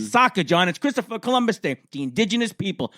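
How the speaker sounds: high-pitched, loud mock mom; indignant mother. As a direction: explain it like an exasperated mother. Mother explaining indignantly in retold exchange.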